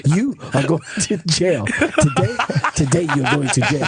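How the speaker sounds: deliberate imitated African accent, indignant; African-accented mock threat. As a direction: imitate your African friend laying down the law. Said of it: "he's got this African accent".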